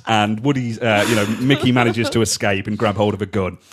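usual speaking voice

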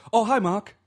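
high, loud sing-out greeting; Tommy Wiseau. Retold or imitated. Imitating the film character greeting whoever walks in, high loud greeting. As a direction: imitate an overeager greeting, pitched well up. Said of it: "he'd always say".

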